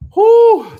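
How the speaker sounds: very high, loud exhale of "whew"; tired whew. Real, not acted. Worn out by the chat, a very high loud whew. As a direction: blow out a big whew. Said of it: "have me tired".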